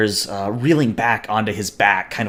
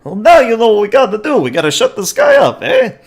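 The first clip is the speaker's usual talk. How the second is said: put-on high, loud, haywire Brooklyn accent; attempted Brooklyn accent; 11 semitones higher, 7 dB louder than usual. Bear tells partner to silence a witness, loud botched Brooklyn accent. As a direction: talk fast like a bad Brooklyn heavy. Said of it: "he's attempting a Brooklyn accent".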